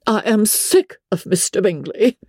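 high, exasperated wife; fed-up cry. A narrator's reading. Wife tired of hearing about Bingley, exasperated. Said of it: "cried his wife".

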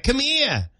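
put-on toddler's Maine-Boston "come he-ah"; Maine-Boston accent. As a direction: call Dad in a little kid's Maine accent. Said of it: "just like that".

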